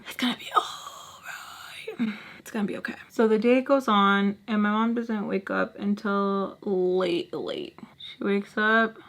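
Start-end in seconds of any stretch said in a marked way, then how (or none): 0.1-2.9 whispered, soft reassurance; calm yourself down in a whisper; talking herself down in a whisper; self-soothing whisper. Real, not acted. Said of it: "Hey, chill".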